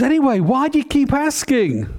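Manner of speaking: very high, pressing mum; exasperated mother bear. Acted. Mother bear to her cub, very high and exasperated. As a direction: push the cub for a yes.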